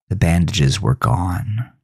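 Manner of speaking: ordinary delivery, nothing marked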